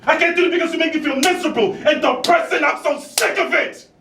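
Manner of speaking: very high, anguished yelling; furious outburst. Acted. Actor blaming the other for their misery, furious. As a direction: break into desperate, enraged shouting.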